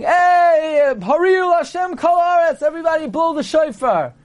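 high, sustained hammed-up proclamation; exuberant calling out. Man who experienced a miracle summons everyone, exuberant high call. Acting out the rejoicing miracle survivor.

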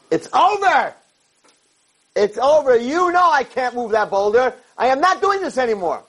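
high, fed-up shouting; angry protest. Acted. Yaakov refusing to push for God, high angry protest. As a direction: throw the job back in his face, high-pitched.

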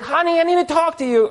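high-pitched put-on wifely call; imitated insistent wife. Wife stopping her rushing husband to talk, high insistent voice.

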